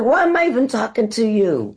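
high-pitched, indignant retort; exasperated comeback. Retold or imitated. Passenger arguing back at a cab driver, exasperated.

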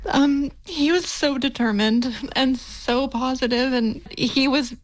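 slowing down as real emotion rises; emotional. Real, not acted. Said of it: "I'm getting emotional hearing that".

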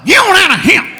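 high, slurred Southern yell; dad's holler. Retold or imitated. Dad yelling at dogs in his yard, hollering. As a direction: holler it as one run-together burst. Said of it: "my dad will run out on the porch and holler".

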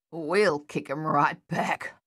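slow, growled threat. A narrator's reading. Luther vowing to hit back at the enemy, growling. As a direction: growl it out slowly. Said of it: "Luther growled".

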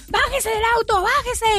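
policeman's order put on high; mock policeman. Policeman orders, retold story.

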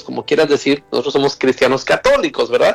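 ordinary delivery

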